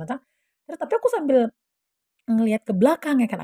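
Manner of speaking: ordinary delivery, nothing marked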